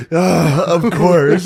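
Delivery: loud, high groan of frustration with laughter; exasperated outburst. Retold or imitated. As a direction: re-enact your fed-up cry, half laughing. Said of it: "she saw me go like".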